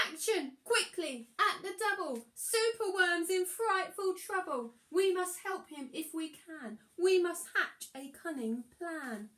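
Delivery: excited garden creatures calling for help; urgent rallying call. Acted. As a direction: rouse the troops in a hurry.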